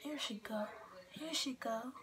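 soft whisper. Real, not acted. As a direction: whisper it to the side. Whispering while finding picture.

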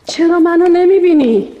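put-on a hurt girl crying out at the cousin who ignores her; anguished outcry. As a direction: drag out the question as if begging to be seen. Hurt pleading outburst.

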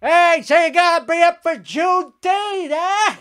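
high-pitched teasing holler; trolling high-pitched call; mock yelling. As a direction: yell it across to a sleeping roommate. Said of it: "gonna yell at him".

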